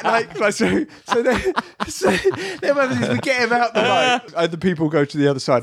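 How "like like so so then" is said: usual speaking voice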